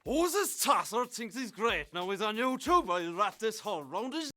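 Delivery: put-on Irish accent. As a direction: play a rough Irish bloke mouthing off. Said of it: "there is no universal Irish accent".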